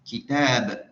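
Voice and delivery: "kitab" with a loud, long "tab"; stress within kitab. Shown as a demonstration. Teacher testing students on tanghim, "kitab" stressed on "tab". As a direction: punch the second syllable of "kitab". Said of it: "Aina tanghim?".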